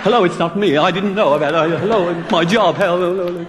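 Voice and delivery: high, flustered mock pleading; retold panic, tumbling over repeated hellos. DJ fearing for his job on air, hammed-up panic.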